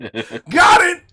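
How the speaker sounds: loud, high victory cry; triumphant shout. Real, not acted.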